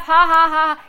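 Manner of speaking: put-on triumphant laughter; demonstrated laugh. Shown as a demonstration. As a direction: laugh it out loud on purpose. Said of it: "And I'll laugh".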